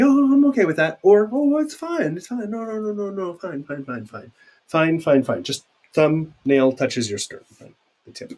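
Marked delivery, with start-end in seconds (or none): 0.0-1.0 high mock casual. Acted example of fine. 1.3-5.4 mock dismissive fine. Teacher acting out a dismissive "fine" for students.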